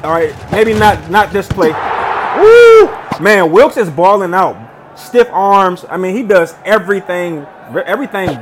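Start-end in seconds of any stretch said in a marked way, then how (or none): 1.7-2.9 loud, high-pitched "woo"; high victory holler; excited whoop. Real, not acted. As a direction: let out a big celebratory whoop. Celebrating a big play.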